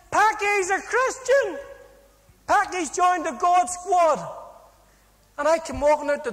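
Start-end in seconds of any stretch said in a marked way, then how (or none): 0.1-4.2 much higher, louder and slower, crowing the news; mocking shout. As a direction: bellow it out to the whole wing like a gleeful cellmate. Cellmate shouting the news outside.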